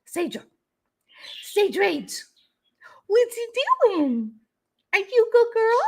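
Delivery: high pet voice calling the dog; talking sweetly to her dog. Real, not acted.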